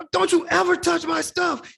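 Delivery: high, loud furious reenacted warning; re-enacted outburst at a snooping friend; angry yelling. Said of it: "I'm yelling at her".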